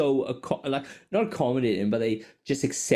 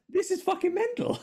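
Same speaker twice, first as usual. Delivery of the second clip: laughing disbelief. Retold or imitated.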